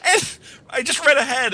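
high excited gasp. Real, not acted. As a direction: burst out, shocked and thrilled. Reacting to the read.

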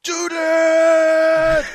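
high loud yell. Acted. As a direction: shout the order. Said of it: "he yelled".

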